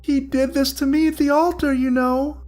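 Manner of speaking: high, strained, melodious voice full of pain and loss; strained ghostly bride. Acted. Ghost bride to Willie, strained, melodious and grieving. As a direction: sing the grief high like a wounded ghost. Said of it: "In a strained, melodious voice replete with pain and loss".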